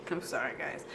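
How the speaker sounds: breathy, unvoiced laugh through sorry; giggling sorry to the viewers; laughing apology. Real, not acted. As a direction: crack up and apologize. Breaks up laughing mid-reading.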